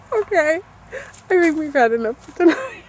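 high, nervous hurry to leave; spooked and getting out fast. Real, not acted. Scared, leaving graveyard.

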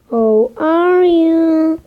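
high, slow drawn-out greeting; put-on voice. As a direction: stretch it out, playful. Greeting zombies in English.

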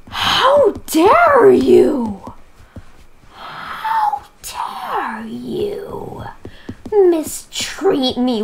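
long drawn, slow whispered outrage; offended whisper. Pretend. To the rude stranger, slow theatrical whisper. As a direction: hiss it out like a wounded diva.

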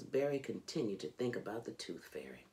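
ordinary delivery

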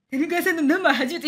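mock leering fan voice, breaking into a laugh; laughing through a creepy quote; creepy read-aloud. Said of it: "いや、キモい、".

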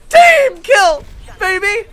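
loud, high shout in the game. Real, not acted.

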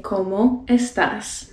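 slow "¿Cómo estás?" with every S sounded; final S pronounced. Shown as a demonstration. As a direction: say "estás" in full, ending on a clear S. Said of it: "drop the S at the end".

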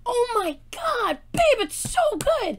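very high, giddy raving; excited gushing. Real, not acted. Fan urging babe to listen to his voice, excited gushing. Gushing to partner about the song.

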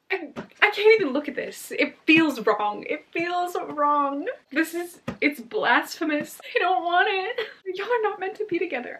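high, loud wail of dismay; upset, high-pitched fan lament; distraught protest. Real, not acted. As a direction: plead against it, nearly crying. Distraught over wrong couple kissing.

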